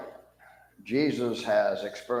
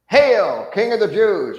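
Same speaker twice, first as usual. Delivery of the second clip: loud, high jeering hail, full voice; mocking shout; 11 semitones higher, 9 dB louder, slower than usual. Soldier jeering at Jesus's face, loud mock shout. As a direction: bellow the hail as a cruel joke. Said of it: "everybody's laughing".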